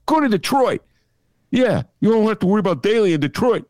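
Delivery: put-on Chicago accent. Chicago Dem scolding Ben about the rules, Chicago accent. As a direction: ham up a Chicago local lecturing Ben. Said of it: "That's what I heard throughout the nineties".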